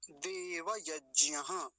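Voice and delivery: देवयज्ञः very slow, ending in breathed ha; full visarga. Shown as a demonstration. Teacher introducing the word, slow with visarga. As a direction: stretch देवयज्ञः as day-va-yaj-nya-ha. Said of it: "विसर्ग भी है साथ में".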